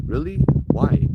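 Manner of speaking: high, questioning yelp of surprise; startled disbelief. Retold or imitated. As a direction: leap up in pitch, taken aback. Retold startled reaction to spirit laughing.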